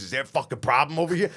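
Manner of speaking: fast, confrontational growl; Tommy's threat. Retold or imitated. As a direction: step in and challenge them quickly.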